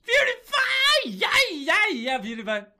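very high screaming cry, fading toward the end; shouted chant; throat-hurting shout. Acted. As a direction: scream until it hurts. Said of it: "I think that hurt my throat".

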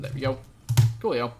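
normal talking voice

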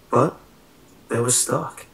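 usual speaking voice

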